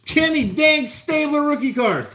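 high, giddy excitement over the cards; can barely contain the hype; thrilled. Real, not acted. Celebrating the rookie card.

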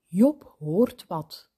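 ordinary delivery, nothing marked

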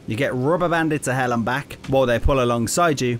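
ordinary talk, nothing marked

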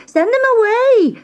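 put-on high screaming girl. A narrator's reading. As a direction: scream it in panic. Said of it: "she screamed".

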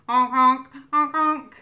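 loud duck honks; duck honking. Pretend. Imitating the animal before naming it.

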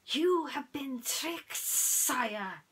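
high, slow snaky warning; hissing snake. Acted. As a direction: stretch it out like a serpent advisor. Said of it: "hissed Hiss".

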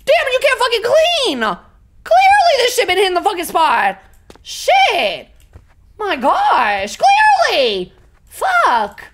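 high, loud, over-the-top complaint; exasperated outburst. Pretend. Player reacting to a filthy house, loudly exasperated. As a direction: yell it out, appalled by the mess.

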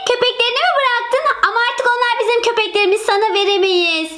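put-on a girl protecting her dogs, slightly quick; indignant little girl. Girl tells the former owner she can't have the dogs back, indignant childish voice.

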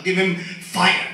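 ordinary talk

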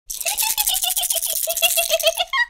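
put-on high little girl giggle; child's high giggle. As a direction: squeal a tiny laugh.

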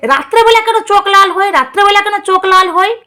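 high, nagging mimicry of the sidekick; sneering impression of a fussy sidekick; mocking the sidekick. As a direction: parrot him in a high, whiny put-on voice. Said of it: "তোর দালাল সবসময় বলে".

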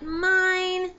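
high, drawn-out cat voice; imitating possessive cat. Cat claiming a new bird toy, high imitated voice. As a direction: claim it in a high put-on pet voice. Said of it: "he was like".

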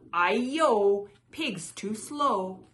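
loud taunt, slowly stretched; crying out. A narrator's reading. Runaway rice cake taunts the pig, crying out. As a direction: crow it out, drawing out the words. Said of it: "It cried".

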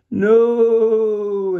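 high, loud, strange drawn-out wail; pathetic wail. A narrator's reading. The shadow wailing as it's drawn into the stick. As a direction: wail like something being dragged away. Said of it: "it wailed pathetically".

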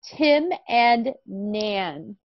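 slow, drawn-out title reading; slow reading aloud. Shown as a demonstration. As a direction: read the title slowly for a child. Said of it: "let's read the title of our story".

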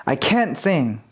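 CAN'T stressed, vowel given in full. Shown as a demonstration. Teacher giving a negative example, stressed can't. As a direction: make 'can't' the strongest word. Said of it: "강세가 있으면 부정일 때가 많다".

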